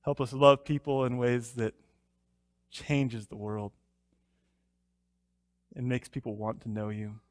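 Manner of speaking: hushed, unhurried prayer voice. Acted. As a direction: pray gently before the study. Leads a closing prayer.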